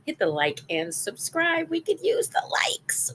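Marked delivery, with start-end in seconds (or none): 1.7-3.1 high, playful whisper; stage-whisper a cheeky plea; begging viewers for likes in a playful whisper. Acted.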